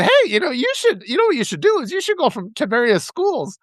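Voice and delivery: high, mock-enthusiastic pitch; facetious suggestion. Teasing the helmetless cyclist to get a rise, facetious. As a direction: tease with an overly helpful proposal. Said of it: "I meant this all facetiously".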